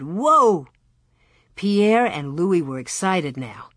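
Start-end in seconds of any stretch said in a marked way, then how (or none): 0.0-0.7 mild halting call; shouted command. A narrator's reading. Almanzo halts his calves, mildly shouted. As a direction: call the team to a stop. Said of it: "stopped when he shouted".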